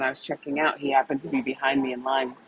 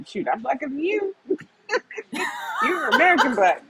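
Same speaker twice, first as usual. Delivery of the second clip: higher, laughing playful jab; laughing tease; 12 semitones higher than usual. Retold or imitated. She teases a friend while laughing. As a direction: tease him through a laugh. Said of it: "but I'd be like".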